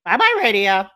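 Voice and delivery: high, loud squawking parrot voice. Acted. The pet parrot squawking at the player, high parrot voice.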